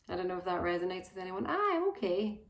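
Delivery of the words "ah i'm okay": high, louder mock-casual reply; breezy brush-off. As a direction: imitate an automatic everything's-fine answer. Acting out a stock reply.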